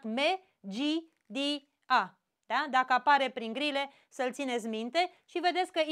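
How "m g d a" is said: each piece of the word said alone with pauses; split into syllables. Shown as a demonstration. Teacher splitting the word for the class, syllable by syllable. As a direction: break the word into its syllables, pausing after each one. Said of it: "îl despart".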